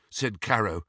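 plain reading voice

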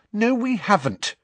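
high, quick protest; indignant objection. A narrator's reading. Student contradicting her professor, indignant.

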